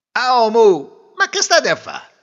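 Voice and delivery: loud, high-pitched hail; calling out. Acted. Groom calling to a man by the river, loud hail. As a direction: hail a stranger with a baffled question.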